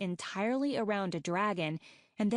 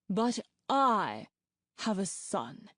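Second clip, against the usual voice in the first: mild pride; slower than usual. A narrator's reading. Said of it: "Mastermind said proudly".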